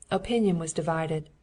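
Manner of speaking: usual speaking voice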